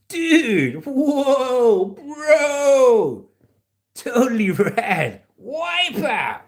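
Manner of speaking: high, loud, stretched surfer exclamations; surfer dude. Acted. Adding dude and bro on request, high surfer voice. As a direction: holler it like a wowed surfer. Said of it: "add some dude, bro, and the words".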